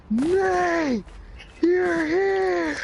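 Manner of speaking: put-on high-pitched, drawn-out drunken cry; drunk buddy. Drunk buddy greeting Nate as he walks in, high slurred holler. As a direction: greet him like an overjoyed drunk. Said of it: "My buddy fucking stumbles on over to me".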